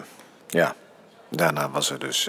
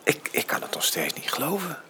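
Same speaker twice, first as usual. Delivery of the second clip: whispered, softer, stammering disbelief; awed whisper; 5 semitones higher, 7 dB quieter than usual. Acted. Father overwhelmed at finding his son, whispering. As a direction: breathe it out in wonder.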